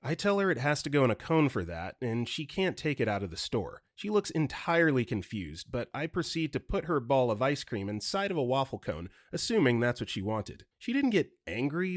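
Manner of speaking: ordinary delivery, nothing marked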